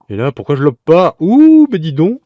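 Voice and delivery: high loud cry of amazement; amazed. Real, not acted. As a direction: yelp in disbelief. Amazed at own replay. (stupéfait, voix aiguë et forte)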